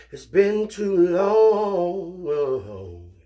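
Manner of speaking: very slow, high, stretched "it's been too long"; drawn out. Acted. Missing his cousins, drawn-out wail. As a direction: stretch every word like a lament.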